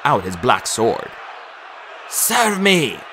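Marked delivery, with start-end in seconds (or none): 2.1-3.0 high, drawn command; commanding call. A narrator's reading. Nico summoning undead warriors, high commanding call. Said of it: "he called".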